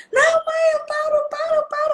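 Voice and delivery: very high-pitched mock pleading; bawling children. Children begging their mother in the toy shop, bawling. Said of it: "os dois começaram a berrar".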